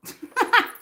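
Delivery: sudden high laugh; high burst of laughter. Real, not acted. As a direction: cackle at the joke. Laughing at chat comment.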